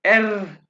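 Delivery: एर said with a trilled tongue R; rolled R. Shown as a demonstration. Teacher shows the class how to roll एर. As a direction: vibrate the tongue on एर. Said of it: "यहाँ पे जुबान को vibrate करना है".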